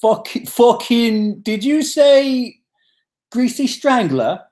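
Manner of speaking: put-on high, slow Grimsby accent; drawn-out, exaggerated Grimsby impression; Ant. Impressing partner's family with his Grimsby accent, high and slow. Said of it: "If you can get his accent down".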